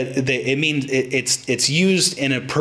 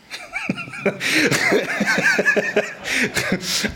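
high squealing laugh. Real, not acted. Laughs at own story.

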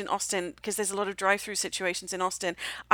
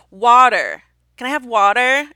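WATER with American vowels and R, loud; put-on American accent; 3 semitones higher, 12 dB louder, slower than usual. British diner making a waiter understand, put-on American accent. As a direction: say water loudly in exaggerated American. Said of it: "have to then say".

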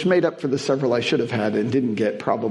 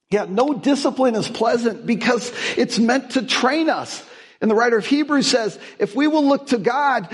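high, raised pulpit drive; preaching at full force; 8 semitones higher than usual. Acted. Pastor telling the congregation hardship is training, with full preaching force. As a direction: sell the hope to the room.

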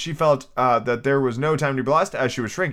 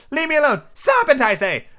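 high, loud, angry outburst; indignant squawking; 14 semitones higher, 4 dB louder than usual. Acted. Pigeon quarrelling with Alice, high and indignant.

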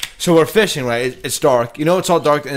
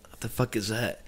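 hushed retold wonder; 4 semitones lower, 14 dB quieter than usual. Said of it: "and I'm like".

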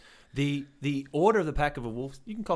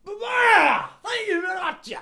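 high, slow, drawn-out victory call; milk the win with a long high thank you; triumphant gloat; 6 dB louder, much slower than usual. Real, not acted. Claiming victory over the cat point, high slow triumphant gloat.

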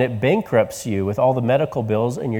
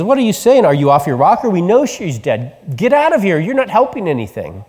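scoffing crowd; 5 semitones higher than usual. Retold or imitated. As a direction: ridicule him like a jeering mourner. Mourners laughing at Jesus.

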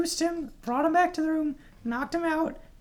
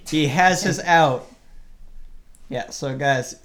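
low male radio voice; Fredericks voice; 10 semitones lower, 6 dB louder, slower than usual. Acted. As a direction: still deep as Fredericks. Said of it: "I have his voice".

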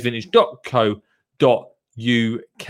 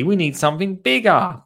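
higher, insistent character line; a hint of a bossy child; lightly voiced child; 6 semitones higher than usual. Lottie asks Dottie for a bigger pot, lightly raised child voice.

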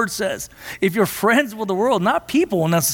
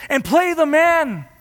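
crying out; 7 semitones higher than usual. Retold or imitated. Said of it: "Latimer cried out to Ridley".

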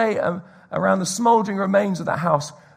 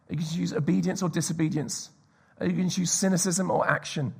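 normal talking voice

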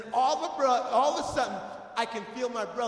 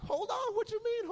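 very high-pitched mock disbelief; comic high-voiced protest; indignant echo. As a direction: squeal the question back at him. Indignant reply to brother's halt.

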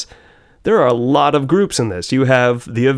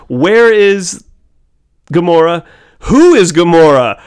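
loud movie quote; quoting a movie line; 6 semitones higher, 8 dB louder, slower than usual. Retold or imitated. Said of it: "I remember it was".

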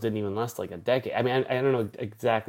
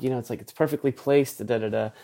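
normal talking voice

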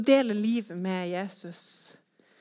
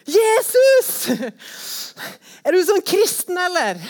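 loud, much higher shouted call; high-pitched shout of disbelief; boy shouting; 8 semitones higher, 9 dB louder than usual. Retold or imitated. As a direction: yell it across the room like a surprised boy. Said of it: "en gutt som bare roper".

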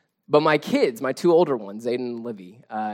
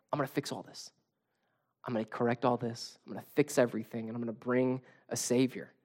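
hushed, lowered voice; quiet promise; 3 semitones lower, 10 dB quieter than usual. Retold or imitated. God promising a Savior after sin enters the world, quiet promise. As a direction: promise it softly and surely.

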